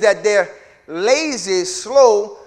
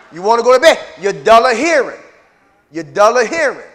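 loud preaching; 3 semitones higher, 10 dB louder than usual. Real, not acted. Preacher calling out the sleepy congregation, loud. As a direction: drive it home to the sleepers.

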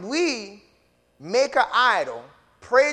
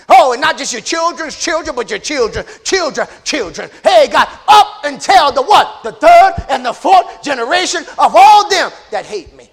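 high, loud, building to a shout; full-force preaching; 7 semitones higher, 13 dB louder than usual. Real, not acted. Preacher warning of punishment on generations, shouted preaching. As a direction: whip the repetition up into a shout.

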